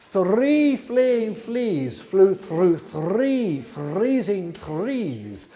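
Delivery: very slow, every F and TH drawn out; very slow tongue twister. Shown as a demonstration. Flute teacher closing with a final twister, very slow. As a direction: pull each word apart slowly. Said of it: "That should get you going".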